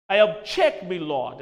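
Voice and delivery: lawyer in court. Retold or imitated. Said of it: "as you might have seen the lawyers doing".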